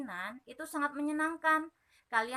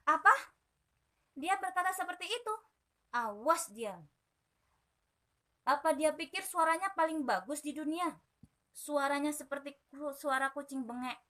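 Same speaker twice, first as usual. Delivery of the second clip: angry cricket; 4 semitones higher, 4 dB louder than usual. Acted. Jerabang furious at insult.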